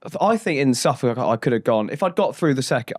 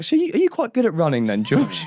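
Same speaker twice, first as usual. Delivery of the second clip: lightly voiced mum, higher pitched with a laugh; clueless mum; 11 semitones higher than usual. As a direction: imitate a mum's innocent, high-pitched question. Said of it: "my mum was like".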